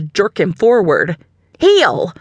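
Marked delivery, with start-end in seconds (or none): 1.6-2.1 sharp, high, loud command; snapped command. A narrator's reading. Nana snaps a command at the dog, loud and sharp. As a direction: snap the order at the dog. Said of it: "she snapped".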